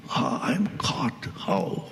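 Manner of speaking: slow hushed whisper of dismay. Acted. Seeker questioning his bondage, slow whisper. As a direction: whisper it as if waking to a trap.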